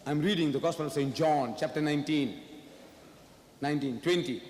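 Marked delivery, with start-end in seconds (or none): none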